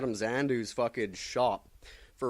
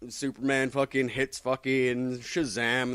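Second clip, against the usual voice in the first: normal talking voice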